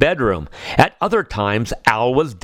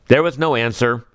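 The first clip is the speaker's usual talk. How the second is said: ordinary delivery, nothing marked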